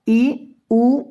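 ordinary delivery

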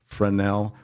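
Fresnel with a silent S, fruh-NEL; accepted French pronunciation. Shown as a demonstration. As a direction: say Fresnel the French way, no S, stress on the end. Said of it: "The accepted pronunciation, French pronunciation".

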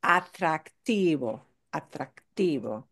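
atractivo with the next-to-last syllable "ti" stressed; atractivo, weight on "ti", falling away after. Shown as a demonstration. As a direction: model atractivo carefully, leaning on "ti". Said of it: "stress the second to last syllable".

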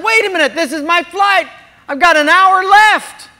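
loud, high-pitched disbelief; acting out a panicked objection; retold protest. Retold protest at gate agent.

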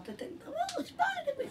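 squeaky, fast mimicry of his denial, laughing; mocking imitation. Teasing partner mimics his flustered denial in a squeaky mocking voice. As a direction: parody his protest in a high silly voice.

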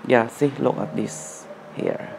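normal talking voice

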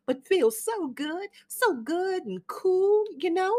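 faded Southern belle charming her son's guest; gushing Southern mother. Acted. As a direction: flutter and fuss over the gentleman caller. Amanda's gushing Southern hostess voice.

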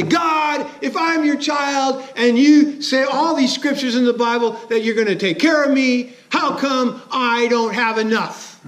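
put-on a hurt child asking God why there's not enough; complaining believer. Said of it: "and say".

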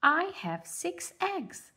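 high, slow motherly duck voice; high gentle mother bird. Acted. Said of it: "said Mother Duck".

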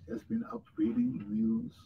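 soft, yawn-stretched words, half breathed; talking through a real yawn; sleepy yawning. Real, not acted. Host apologizing to viewers, mid-yawn and sleepy. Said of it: "excuse the yawning".

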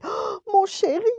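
very high, loud storybook yelp. A narrator's reading. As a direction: squeal it out as the sled takes off. (glapissement aigu et fort)

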